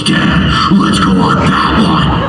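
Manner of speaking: low, slow, creepy growl of a daughter gone wild; loud, low demonic voice; possessed daughter. Retold or imitated. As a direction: sound like a thrill-crazed monster kid. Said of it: "and goes".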